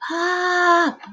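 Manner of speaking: high, breathy call out to a father; high exclamation of relief; relieved cry. A narrator's reading. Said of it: "I whisper in relief".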